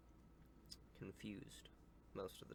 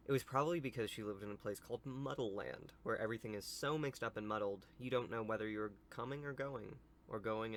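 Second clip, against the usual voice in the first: louder storybook read-aloud; story narration; 6 dB louder than usual. Acted. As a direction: read it out to children, projected.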